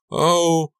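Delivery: loud high yelp; startled cry. A narrator's reading. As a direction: cry out as grabbed. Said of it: "I uttered a startled cry".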